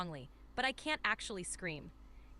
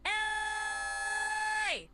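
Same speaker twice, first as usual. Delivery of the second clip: very high, sustained shout; long level cry, dropping at the end; emphatic held call; 11 semitones higher than usual. Shown as a demonstration. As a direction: stretch it out on one high, steady note. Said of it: "go, like, a little more".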